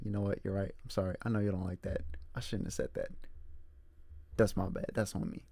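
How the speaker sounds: soft apology demo. Shown as a demonstration. Coaching Bear on apologizing to her, soft and quiet. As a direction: murmur the apology gently. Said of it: "You're like".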